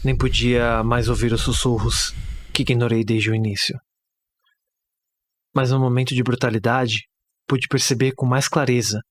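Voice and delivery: grave, pausada horror narration; narração de conto de terror. Acted. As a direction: narrate a bleak horror tale, measured, with long pauses. (narração sombria de conto de terror)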